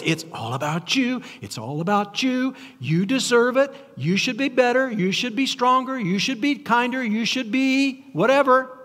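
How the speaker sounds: culture's sales pitch, climbing in pitch; seductive voice of culture. Acted. Culture's message to the listener voiced.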